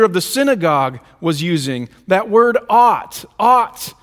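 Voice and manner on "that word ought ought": "ought" pressed slow and heavy; stress on ought. Shown as a demonstration. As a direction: lean hard on "ought". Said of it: "borrowing the same language".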